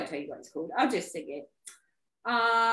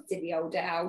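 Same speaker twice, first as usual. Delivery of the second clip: ordinary delivery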